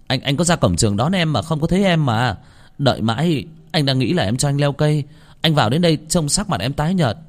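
high, stammering panicky excuses; flustered pleading. A narrator's reading. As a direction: talk fast to save yourself. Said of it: "Khải thật sự hoảng hốt". (giọng cuống quýt, lắp bắp)

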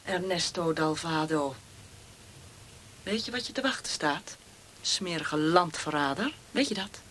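low menacing threat. Acted. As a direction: hiss the threats close to his ear. Interrogator threatens quietly.

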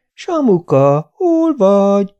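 lassú, magas, éneklően elnyújtott hívás; becézve hívogasd, mint egy kiskutyát; szólítgatva. A narrator's reading. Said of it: "Szólítgatták ... mintha csak egy macska". (elnyújtott, dallamos szólongatás)

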